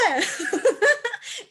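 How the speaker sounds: high, loud laugh at the memory; bright burst of laughter. Real, not acted. Teacher laughing at her own classroom story, loud and high. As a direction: let a real laugh burst out. Recalling funny classroom mix-up.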